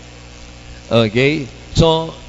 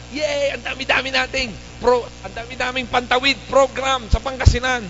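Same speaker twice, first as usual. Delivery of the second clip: mock cheering; 8 semitones higher than usual. Mock cheer over poverty aid.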